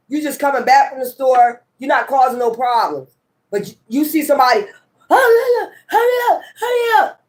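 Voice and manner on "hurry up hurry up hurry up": high, urgent yelled commands; imitating a cop yelling over the loudspeaker; mock loudspeaker yelling. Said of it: "Calling shit on the loudspeaker".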